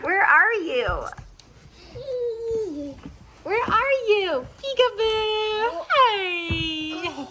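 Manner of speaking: high, slow singsong peekaboo; peekaboo play. Pretend. Mother playing peekaboo with baby, high and drawn out. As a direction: draw out peekaboo to tease the baby.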